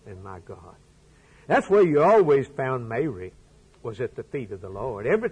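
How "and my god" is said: hushed, much softer and lower; hushed cry. A narrator's reading. Thomas fallen at his feet, a hushed low cry. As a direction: breathe it out, overwhelmed. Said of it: "and cried".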